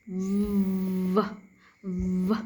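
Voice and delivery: "V" as a long low buzz ending in "vuh"; voiced V. Shown as a demonstration. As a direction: buzz "V" on the lip with voice. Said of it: "V sound become the V sound".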